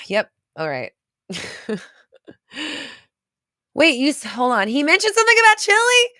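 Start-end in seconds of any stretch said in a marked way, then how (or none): 3.7-6.1 sudden high, loud disbelief; surprised. Real, not acted. Streamer reacting to a chat message, surprised and loud. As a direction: jump up in pitch, caught off guard.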